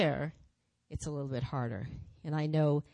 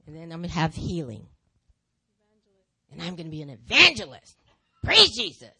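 louder, triumphant claim; proud declaration; 10 dB louder than usual. Acted. Acting out her gifts to the congregation, loud and proud. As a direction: announce it like a victory.

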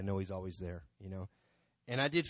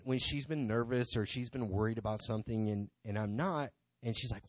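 normal talking voice

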